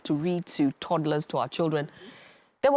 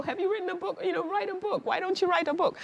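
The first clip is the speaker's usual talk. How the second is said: much higher, eager coaxing; urging people to write; 13 semitones higher than usual. Retold or imitated. Author urging everyone she meets to write a book, bright and eager. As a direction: coax them warmly to just write it. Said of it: "I always tell people".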